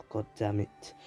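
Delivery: softly imitated swearing; understated, quiet mock curse; retold muted curse. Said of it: "Naruto's like".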